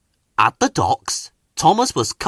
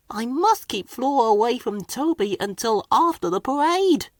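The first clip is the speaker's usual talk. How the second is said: much higher, softer Thomas voice; worried little engine; 11 semitones higher than usual. A narrator's reading. Thomas plotting to himself, quiet and higher. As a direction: scheme softly, a little anxious. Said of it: "he worried quietly".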